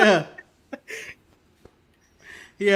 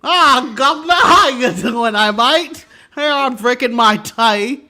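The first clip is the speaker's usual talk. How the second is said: hammed-up louder British accent; mock British accent; 5 dB louder, slower than usual. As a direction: play a clichéd cockney bloke. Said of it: "His accent is rubbish".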